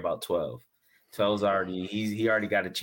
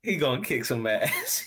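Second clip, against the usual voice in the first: laughing; 5 semitones higher than usual. Real, not acted. Laughing while echoing friend.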